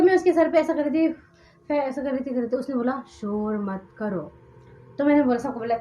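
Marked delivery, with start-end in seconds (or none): 3.2-4.3 low, drawn-out ghostly order; भूत लगी आवाज़. Retold or imitated. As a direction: imitate the possessed girl, very slow and soft. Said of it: "आवाज बदल, पूरा change". (धीमी, नीची भूतिया आवाज़)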